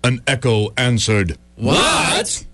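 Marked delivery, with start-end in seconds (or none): 1.6-2.4 mocking shout. Mocking echo answers Casey.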